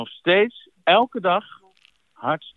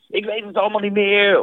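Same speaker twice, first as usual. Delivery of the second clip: panicked client; 6 semitones higher than usual. Retold or imitated. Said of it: "Van, eh".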